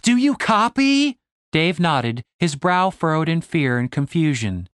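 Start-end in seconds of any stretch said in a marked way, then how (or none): 0.0-1.2 high, loud, slow shout. Acted. Stacy shouts into Dave's face, high, loud and slow. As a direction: bellow it right in his face. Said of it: "shouted into his face".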